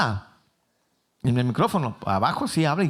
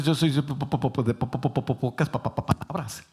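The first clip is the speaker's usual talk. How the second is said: stuttering on po and pa, fading quieter; mock stammer of a shy man; imitated stutter; 4 dB quieter than usual. Moses telling God he has few words, stuttering. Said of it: "Y no era de que era tartamudo".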